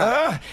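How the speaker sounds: high, strained swinging "ah"; mimed effort groan. Retold or imitated. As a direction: grunt as if pumping a swing with your legs. Mimics pumping legs on the swing.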